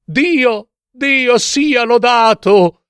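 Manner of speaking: high, drawn-out joyful cry; exclaiming. A narrator's reading. Sister thanks God for the rescuers, exclaiming. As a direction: exclaim with huge relief, high-pitched. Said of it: "esclamò un attimo dopo".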